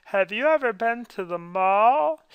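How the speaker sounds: high, loud, drawn-out mock question; mock-vapid question. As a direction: ask it like a hammed-up, empty-headed hook.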